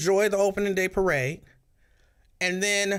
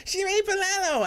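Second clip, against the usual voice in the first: very high, squealing mock yell of the name; schoolgirl yell. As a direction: shriek the name like an excited little schoolgirl. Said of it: "he just kept screaming".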